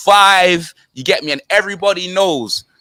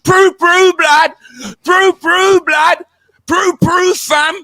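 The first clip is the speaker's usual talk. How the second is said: mock gunshot noises; 9 semitones higher, slower than usual. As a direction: fire off the sounds like a kid playing guns. Mimicking a shooting sound.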